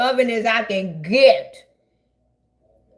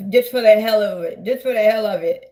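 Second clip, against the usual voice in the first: usual speaking voice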